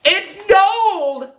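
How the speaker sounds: high, loud cry of discovery; excited yell. A narrator's reading. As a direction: yell the find out to everyone. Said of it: "stood up and yelled".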